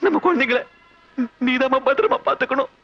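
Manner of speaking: husband begging wife, pitch climbing; rising plea. Acted. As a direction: beg her to keep the children safe. Pleading with wife about children.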